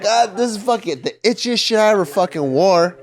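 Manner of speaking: high mock whine about itchiness; kid complaining. Kid in hot L A complains about the itchy sweater, high and whiny.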